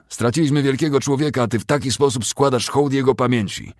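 high, loud bitter reproach; bitter rebuke. A narrator's reading. Captain rebuking a soldier over the fallen general, bitter. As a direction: shame him for dishonouring the dead.

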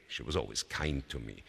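ordinary delivery

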